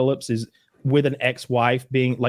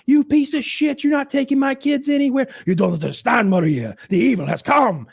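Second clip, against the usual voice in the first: high-pitched frantic mock yelling; hammed-up panicked family row; screaming argument; 12 semitones higher, 3 dB louder than usual. Parents fighting over the kids, frantic acted screaming. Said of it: "back to the argument. It's just so stressful".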